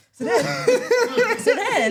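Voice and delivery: laughing hard, high and loud; can't get words out for laughing. Real, not acted. Bursts out laughing mid-story.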